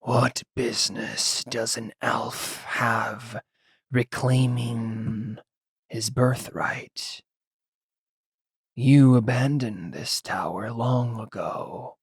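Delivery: slow, soft, raspy whisper. Acted. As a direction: rasp each word out slowly from the shadows. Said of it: "a raspy voice say".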